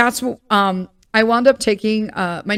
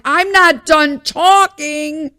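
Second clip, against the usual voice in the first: slow, pointed and raised; indignant retort; 6 semitones higher, 4 dB louder, much slower than usual. Retold or imitated. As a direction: spell out every word to the waiter. Retold snapping at the waiter.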